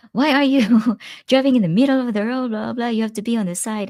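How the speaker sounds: high, fast, loud scolding driver; mock angry yell. Angry driver yelling at her from his car, high and fast. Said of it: "And he was yelling at me".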